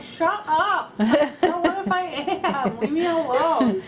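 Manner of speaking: loud, high, exasperated yell; mock angry mom. Mom caught crying at a movie, mock angry yell. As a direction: snap back loudly, hamming up the outrage. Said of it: "used to get so mad at me".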